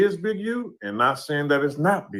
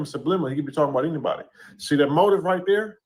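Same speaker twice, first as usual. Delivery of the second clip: normal talking voice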